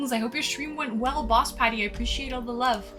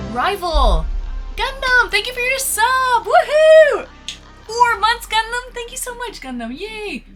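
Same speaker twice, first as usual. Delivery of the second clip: high, loud, thrilled thanks; excited squeal; 11 semitones higher, 7 dB louder than usual. Real, not acted. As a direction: squeal with real joy. Thanking a sub on stream.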